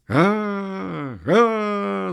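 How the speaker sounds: high, drawn-out roar of assent; long high crowd yell; crowd clamour. A narrator's reading. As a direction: roar back like a noisy crowd. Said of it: "зашумела толпа".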